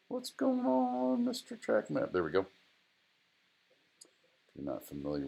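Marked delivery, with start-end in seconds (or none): 0.1-2.1 very high squeaky silly voice. Acted. Teacher greeting his track map in a silly high voice.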